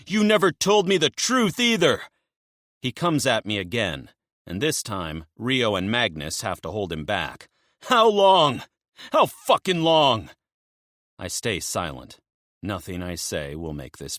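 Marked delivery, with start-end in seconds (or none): 0.0-2.0 furious yell. Acted. Emil yelling at friend. 7.8-10.3 furious yell. Acted. Emil held back, screaming.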